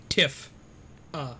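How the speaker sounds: Tiff, then uh, split by a pause; syllable-by-syllable pronunciation. Shown as a demonstration. Streamer teaching chat the name Tifa, sounding it out. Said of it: "It's pronounced Tifa".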